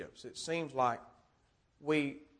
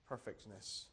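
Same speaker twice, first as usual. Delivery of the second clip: hushed echo; 6 semitones lower, 20 dB quieter than usual. Shown as a demonstration. Quiet echo of the verse word.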